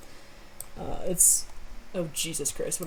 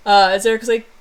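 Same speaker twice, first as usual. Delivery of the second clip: ordinary talk, nothing marked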